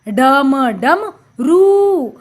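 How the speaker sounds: डम, pause, then a long रू; sounding out. Shown as a demonstration. Teacher showing children the pronunciation, slowly in pieces. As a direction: split it and stretch रू. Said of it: "हमने इसका उच्चारण किया".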